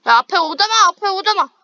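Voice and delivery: urgent warning shout. Real, not acted. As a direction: yell the warning to your teammate. Warning teammate of enemy ahead. (다급하게 경고하는 외침)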